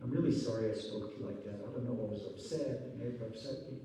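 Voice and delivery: soft, low, quick mock apology from the wife; a hushed, lightly voiced sorry; quiet apology. Wife apologizing to husband, soft and quick. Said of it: "and she says".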